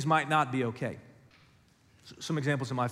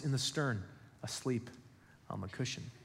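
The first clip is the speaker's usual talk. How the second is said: hushed; 4 semitones lower, 11 dB quieter than usual. Real, not acted. Preacher describes Jesus asleep.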